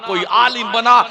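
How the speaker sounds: ordinary delivery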